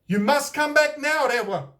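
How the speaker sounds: stern call upward; demanding. A narrator's reading. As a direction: order him down from the sky. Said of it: "demanded the tūhunga".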